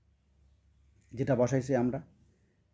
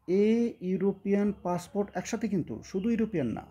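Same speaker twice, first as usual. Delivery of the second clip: ordinary talk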